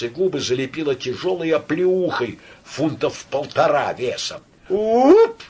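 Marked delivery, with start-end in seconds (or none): 4.7-5.4 high, loud cry of a slapped man; thin yelp. A narrator's reading. Thin-voiced man struck in the face, yelping. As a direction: yelp as the blow lands. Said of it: "крикнул тонкий голос".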